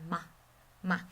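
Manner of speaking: touch "ma" quickly and gently; neutral tone. Shown as a demonstration. Teacher demonstrates the unstressed tone, light and quick. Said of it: "go through it like a whisper".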